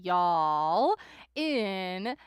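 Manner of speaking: sing-song farewell rising to a bright finish; drawn-out cheery sign-off. Real, not acted. Saying goodbye to the viewers until next time, drawn-out bright sign-off. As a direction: stretch it out then jump up loud on next one.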